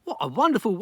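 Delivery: plain reading voice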